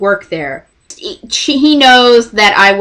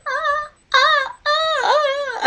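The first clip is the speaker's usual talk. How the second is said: strangled high call; 8 dB quieter, much slower than usual. Retold or imitated. Trying to call Jeff.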